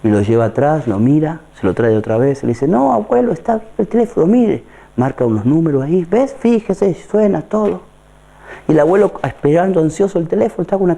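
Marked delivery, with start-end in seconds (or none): 2.7-7.8 shopkeeper reassuring. Acted. Shopkeeper voiced in story.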